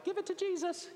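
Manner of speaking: high, sweet motherly voice; mom's advice. Retold or imitated. Mom through the bathroom door, high and sweet. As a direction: coo it like a gentle mom.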